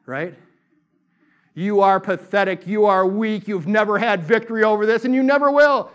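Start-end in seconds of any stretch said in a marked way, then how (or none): 1.5-5.9 domineering enemy voice, rising to a yell; roaring accuser. Acted. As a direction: roar it into their mind, louder to the end. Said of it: "the enemy powers and principalities roar".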